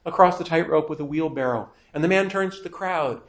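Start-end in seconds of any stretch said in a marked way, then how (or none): none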